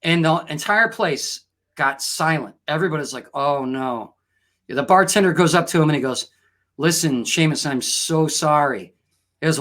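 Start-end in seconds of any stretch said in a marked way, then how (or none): 3.3-4.1 low, sinking dismay; acted murmur of a worried crowd; hushed dismay. Silent pub reacts with dread. 6.8-8.9 low, grave sympathy; slow down as you offer condolences; acted bartender breaking it gently; solemn condolence.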